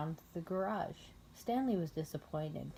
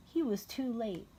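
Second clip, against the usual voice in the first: plain reading voice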